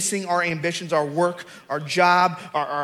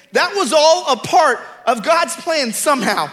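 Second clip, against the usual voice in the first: high, loud preacher's declaration; ringing sermon proclamation; full-force preaching; 8 semitones higher, 7 dB louder than usual. Real, not acted. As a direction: drive the point home to the congregation, loud and high. Preaches to a climax.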